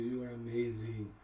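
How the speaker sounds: normal talking voice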